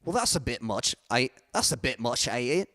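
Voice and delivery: casual English accent with swallowed T's; amateur impression of a T-dropping English accent; British accent, dropped T's. Shown as a demonstration. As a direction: do a loose British accent, barely sounding the T's. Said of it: "That's my best impression of it".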